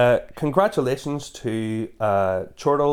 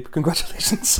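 laughing; 6 semitones higher than usual. Real, not acted. Mock applause for segue.

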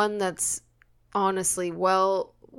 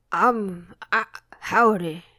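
cowboy voice; 3 semitones lower than usual. Pretend. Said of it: "our best cowboy voices".

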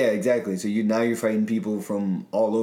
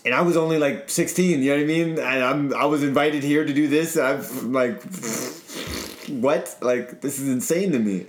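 raised, eager retelling; excited; 5 semitones higher than usual. Real, not acted. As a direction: tell it wide-eyed and thrilled. Excited about world event.

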